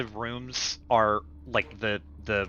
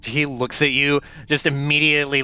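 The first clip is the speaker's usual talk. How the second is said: ordinary delivery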